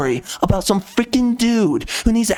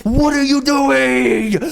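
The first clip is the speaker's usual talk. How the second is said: incredulous outburst; 5 semitones higher, much slower than usual. Real, not acted. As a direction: throw it out like you can't believe it. Exasperated at the game's writers.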